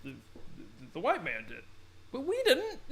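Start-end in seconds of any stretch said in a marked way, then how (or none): 2.1-2.8 very high, loud comic squeal; mock protest. Acting out tribe's protest.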